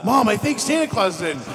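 put-on high kid voice; excited little kid; child voice. Child voice in skit.

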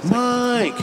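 high, sustained echoing holler; drawn-out call. Pretend. Co-host calling from afar, high drawn-out call. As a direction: call the name down a tunnel. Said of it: "and I was like".

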